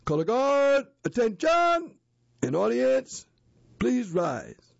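high, loud parade-ground call; leader's command. Shown as a demonstration. Scout leader calling the color guard to attention, loud. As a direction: bark it across the room. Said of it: "the leader says".

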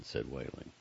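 usual speaking voice

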